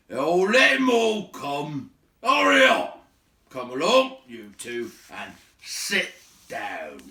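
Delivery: loud, high, slow bellow; gruff host hollering at dwarves; gruff shout. Acted. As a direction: roar them in impatiently. Baron shouting at arrivals.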